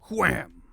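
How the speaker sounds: loud wham effect; loud spoken wham. Acted. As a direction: punch out the impact. Impact sound effect.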